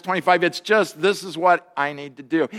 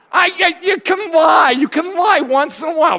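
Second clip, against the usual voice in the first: loud exasperated exclaim; 10 semitones higher, 5 dB louder than usual. Real, not acted. Pastor protesting the technician's answer, shouting high. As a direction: shout it high, half laughing. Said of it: "pastor exclaiming at congregation".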